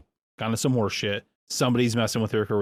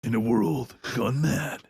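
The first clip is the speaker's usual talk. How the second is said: movie trailer voice; slower than usual. Pretend. Trailer voice cue.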